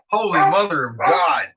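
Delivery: normal talking voice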